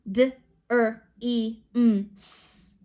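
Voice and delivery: "D, er, e, m, s" as five isolated sounds; sounded out. Shown as a demonstration. Teacher shows suffix adds a sound, segmented. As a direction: split "dreams" sound by sound, ending on hissed s. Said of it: "I know it adds a sound".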